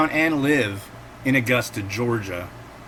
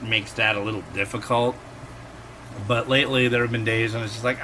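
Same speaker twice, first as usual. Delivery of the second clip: normal talking voice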